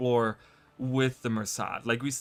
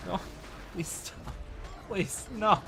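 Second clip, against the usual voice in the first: pleading; 7 semitones higher, 5 dB quieter than usual. Real, not acted. Pleading with attackers.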